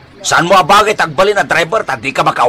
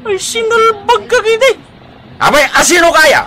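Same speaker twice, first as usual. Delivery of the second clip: high-pitched indignant cry; outraged old man; 13 semitones higher than usual. Acted. Grandfather outraged at the young man telling him to relax. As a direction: squawk in outrage.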